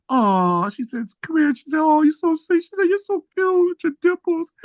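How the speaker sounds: high, cooing imitation of a woman; sweet flirting woman.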